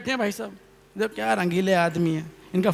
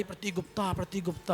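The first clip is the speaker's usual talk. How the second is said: far softer repeats of the name; mock echo; 13 dB quieter than usual. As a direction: imitate the echo coming back faintly. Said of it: "आवाज़ आई". (नाम की हल्की गूँज)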